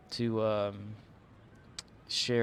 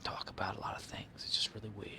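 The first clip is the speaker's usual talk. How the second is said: soft unvoiced whisper; 2 semitones lower, 10 dB quieter, faster than usual. Real, not acted. Streamer musing about Walken, whispering. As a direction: breathe it out like a secret.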